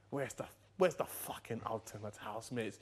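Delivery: ordinary talk, nothing marked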